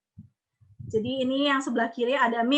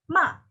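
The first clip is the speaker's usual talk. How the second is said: "ma" short, falling sharply from high; quick downward "ma"; fourth-tone MA; 5 semitones higher, 3 dB louder than usual. Shown as a demonstration. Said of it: "nada empat yang terakhir".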